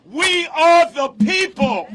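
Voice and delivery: loud, high shouted rally cry; roaring rallying speech. Real, not acted. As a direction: belt it to the crowd.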